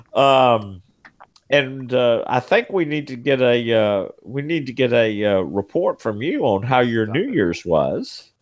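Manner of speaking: usual speaking voice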